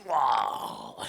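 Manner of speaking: mock dragon death cry, falling in pitch; dragon's cry. Storyteller as dragon struck by a sword, mock dying cry.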